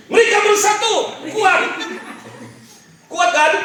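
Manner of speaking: high, loud exclamation of triumph; rousing shout of unity; excited preacher shout. Real, not acted. Preacher exclaims during demonstration.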